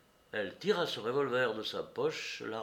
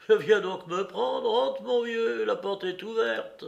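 high, loud challenge, slow to start; taunting dare; 9 semitones higher, 7 dB louder, slower than usual. A narrator's reading. Woman at window invites Courrapier in, taunting. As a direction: dare him to come in. Woman dares him to enter.

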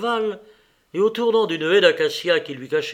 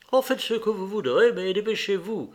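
raised, offhand brush-off; hurried dismissal; 6 semitones higher than usual. A narrator's reading. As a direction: wave away the questions, higher in pitch. Brushes off housekeeper while leaving.

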